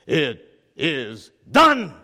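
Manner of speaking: slow, low, weighty; solemn Jesus voice. Acted. Jesus declares the end, slow solemn pronouncement. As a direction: lay each word down with finality.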